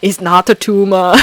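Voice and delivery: very loud action-star impression; Arnold Schwarzenegger. Quoting the action star for viewers, loud impression. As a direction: belt it out with an Austrian-style accent. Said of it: "for all the Arnold quotes".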